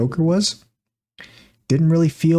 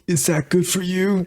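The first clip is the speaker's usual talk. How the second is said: slow, higher put-on character voice; lightly drawn-out, odd little movie voice; Arthur; 5 semitones higher than usual. Co-host quoting friend's remark at the movie, in character voice. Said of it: "did it in the Arthur voice".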